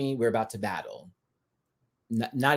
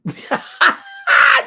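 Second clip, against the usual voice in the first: high, loud cackle; shrieking laugh; 9 dB louder than usual. Real, not acted. Cracking up at Jasmine's wig with a high shriek. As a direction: burst into a screaming laugh.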